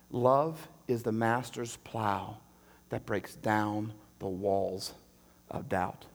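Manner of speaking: low, very slow and soft; tender lyric. Retold or imitated. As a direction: savour each word of the line. Quoting song lyric.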